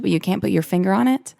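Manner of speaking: ordinary talk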